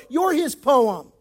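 ordinary delivery

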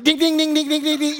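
high rapid-fire dings; ringing string of dings on one high note; imitated phone alerts. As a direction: chime out a flood of notifications.

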